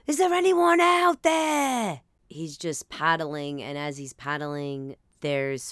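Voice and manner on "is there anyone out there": far-off calling, much higher and louder, slow; rescuer calling out. Retold or imitated. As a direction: hail survivors across the water. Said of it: "And he's like".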